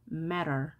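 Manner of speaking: matter with T softened to an R-like flap; American flapped T. Shown as a demonstration. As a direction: say matter American-style, like merrer. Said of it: "T가 양쪽에 모음이 있게 되면 R 발음".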